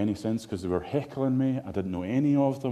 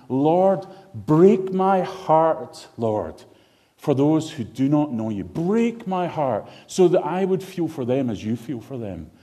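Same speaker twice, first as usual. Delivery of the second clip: slow, loud, drawn-out plea to God; fervent prayer in the middle of the sermon; heartfelt prayer; 4 semitones higher, 6 dB louder, slower than usual. Acted. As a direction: cry out the prayer, stretching break my heart. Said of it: "we want to be able to say".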